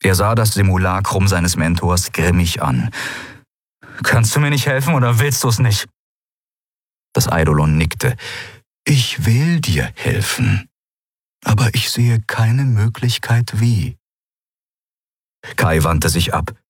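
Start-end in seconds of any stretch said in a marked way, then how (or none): 4.0-5.9 higher, pressing demand; impatient challenge. A narrator's reading. Kai demanding help from the spirit, impatient. 8.8-14.0 slow, slightly raised ghostly voice; helpless spirit. A narrator's reading. Mentor's spirit admitting helplessness, slow and measured.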